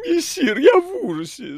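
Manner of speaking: very high, loud theatrical wail; hammed-up horror, squealed; cat howling in feigned horror. A narrator's reading. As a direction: howl as a cat faking terror. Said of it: "завыл кот, изображая ужас на своей морде".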